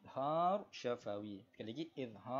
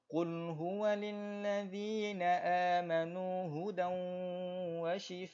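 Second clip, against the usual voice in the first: tajwid recitation demo; 4 semitones higher than usual. Shown as a demonstration. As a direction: show the class exactly how it flows. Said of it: "jadi cara bacaan dia macam mana".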